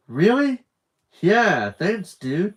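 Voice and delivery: high, loud burst of delight; light up at the offer. Acted. Jeremiah thrilled when Mike offers a shake, excited. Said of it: "Jeremiah lit up".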